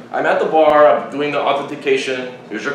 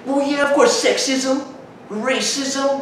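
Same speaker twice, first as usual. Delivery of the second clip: high-pitched mock know-it-all voice; argumentative girl; 12 semitones higher, much slower than usual. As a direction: imitate her listing grievances. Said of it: "And, and she's like".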